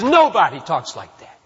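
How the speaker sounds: loud, falling, hammer it home; loud emphatic declaration. Acted. Preacher driving home his point to the congregation, loud.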